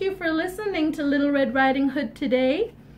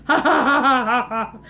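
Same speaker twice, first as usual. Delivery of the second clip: loud ha-ha-ha cackle; hammed-up evil laugh; villainous wolf laugh; 4 semitones lower, 4 dB louder than usual. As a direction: laugh big as the hungry wolf. Wolf laughs after pouncing.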